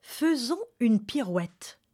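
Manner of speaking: ordinary delivery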